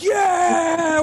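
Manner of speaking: very high, drawn-out cheer; crowd screaming. Retold or imitated. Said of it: "We're all screaming".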